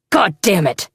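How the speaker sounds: sudden angry outburst; shouting. A narrator's reading. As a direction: yell it out in anger. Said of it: "Poe shouted".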